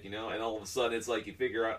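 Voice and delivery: normal talking voice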